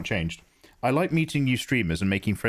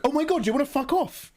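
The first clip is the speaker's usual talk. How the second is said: very high, squeaky exasperated snap; mock outraged reply. Streamer acting out snapping at a viewer, very high and squeaky. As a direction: fling it back in mock outrage. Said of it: "acted rude reply".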